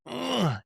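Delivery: imitated exasperated "ugh", high-pitched; frustrated grunt. Retelling his wife's outburst in an argument, frustrated grunt. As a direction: mimic a short burst of frustration. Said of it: "got so frustrated, she went".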